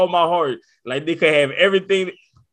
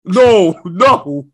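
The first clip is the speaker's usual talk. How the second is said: loud, rising refusal, laughing on the last; emphatic no; 5 dB louder, much slower than usual. Real, not acted. Dad rejecting the idea of kids, loud refusal. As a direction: shut it down hard, no, no, no.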